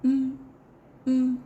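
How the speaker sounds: plain reading voice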